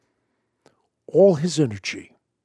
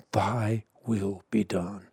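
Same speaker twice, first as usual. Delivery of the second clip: soft reverent prayer; 5 semitones lower, 7 dB quieter than usual. Acted. Quoting Jesus' prayer.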